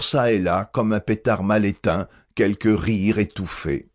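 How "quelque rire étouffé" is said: usual speaking voice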